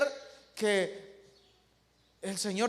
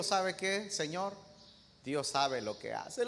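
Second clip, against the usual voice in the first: a quiet, humble servant answers his king gently; humble servant, hushed; 6 semitones lower, 9 dB quieter than usual. Acted. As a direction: speak softly, like a meek servant. (siervo humilde, voz baja y serena)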